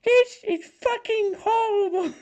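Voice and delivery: high, strained gamer rant voice; raging gamer. Pretend. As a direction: rage like a furious streamer. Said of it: "can we get a Raging Gamer review".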